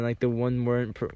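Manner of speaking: usual speaking voice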